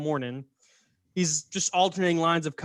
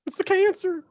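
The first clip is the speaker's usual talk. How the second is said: very high falsetto wail; hammed-up squeal of dread; mock horror; 12 semitones higher than usual. Gullible crowd sees the fake cancer, mock-horrified wail.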